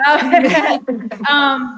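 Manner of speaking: loud laughing um. Real, not acted. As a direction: laugh at your own blooper. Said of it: "This is a blooper".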